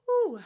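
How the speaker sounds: high loud gasp of relief; relieved whew. Real, not acted. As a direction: blow out after holding breath. Out of air after breath hold.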